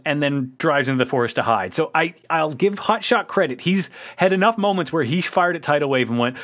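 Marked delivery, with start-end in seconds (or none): none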